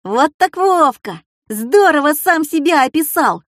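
high, excited classroom exclamations; children chiming in. A narrator's reading. Classmates teasing the essay writer, excitedly chiming in. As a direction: call out delightedly like schoolchildren. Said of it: "подхватили другие ребята".

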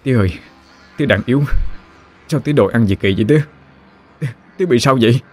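frightened plea. Acted. Son pleads with possessed father.

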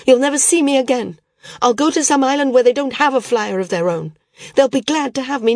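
fast, high and loud, lashing out; furious outburst. Acted. Daughter storming at her father, fast, high and loud. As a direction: hurl it at him in a rage.

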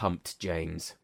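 ordinary delivery, nothing marked

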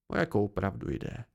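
mildly irritated, low-key question; a touch of impatience. A narrator's reading. Chronicler pressing Bast about the truth, irritated. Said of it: "Zeptal se podrážděně kronikář".

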